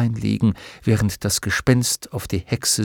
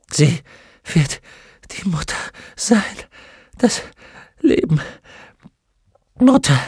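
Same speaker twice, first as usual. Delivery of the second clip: one word at a time, high and strained; gasping, broken fragments rising to a cry; tortured, halting speech; 10 semitones higher, 3 dB louder than usual. Acted. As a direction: force each word out through agony. Tortured witch forces out words.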